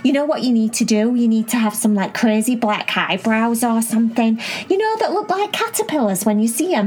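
put-on bubbly, high-pitched little girl; excited high girl voice. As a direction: squeal it out, eager and excited. Cherry voiced in a story.

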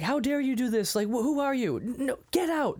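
high-pitched indignant crowd, acted out; hammed-up indignation, high but not shouted; mock outrage. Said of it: "they're mad at him".

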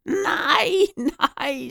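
very high, strained cry; screamed protest. A narrator's reading. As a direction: squeal it in horror. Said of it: "skreg Hyggen".

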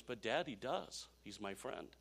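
imitated hurt child, hushed; tearful boy. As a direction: plead quietly with dad. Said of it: "with tears in his eyes".

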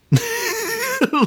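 laughing so hard it nearly turns to crying; helpless high-pitched laughter. Real, not acted. Laughing at the bullet logic.

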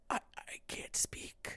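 breathless whisper. Retold or imitated. Buddy crying from spicy wings, choked whisper. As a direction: barely get the words out, voiceless. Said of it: "He's just like".

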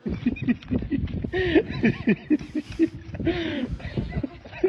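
long high burst of laughter; real laugh. Real, not acted. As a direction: laugh out loud at the silly sheep. Laughs at the sheep prop.

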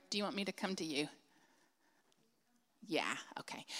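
quick whispered yes; hushed aside to Lynette. Real, not acted. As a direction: murmur it off mic. Pastor checks with Lynette off mic.

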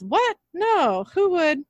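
high-pitched nervous denial. A narrator's reading. Sam covering up to the doctor, nervous. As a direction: deny it nervously, pitch jumping up. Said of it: "Sam laughs nervously and says".

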